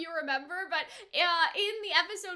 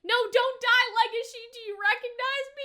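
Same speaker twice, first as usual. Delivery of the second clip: panicked plea; 7 semitones higher, 5 dB louder than usual. Retold or imitated. Jack fearing Legacy dies.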